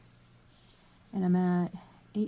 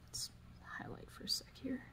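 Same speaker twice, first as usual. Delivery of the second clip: soft whispered self-talk; whispering; 6 semitones higher, 14 dB quieter than usual. Real, not acted. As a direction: whisper it to yourself while working.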